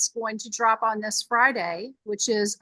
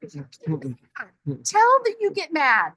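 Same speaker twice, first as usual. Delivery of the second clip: drawn-out tease; 3 semitones higher, much slower than usual. Retold or imitated. Retold jab at husband.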